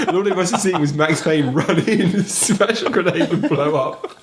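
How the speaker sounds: high and slow between laughs; gasping out the story in giggles; laughing through it. Real, not acted. Laughing while telling story.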